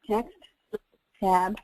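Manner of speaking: each word spaced out slowly for note-taking; slow dictation. Shown as a demonstration. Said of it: "You might wanna write this down".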